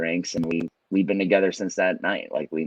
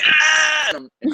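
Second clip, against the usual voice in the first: loud hyped-up yell; amped up shout; 6 dB louder than usual. Retold or imitated. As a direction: blast it like a morning DJ. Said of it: "I'm standing at the front of the house, and I'm like".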